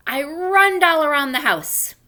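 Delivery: reads the line through a laugh; laughing misread. Real, not acted. Teacher laughing at her own slip to the class. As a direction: giggle while reading it out.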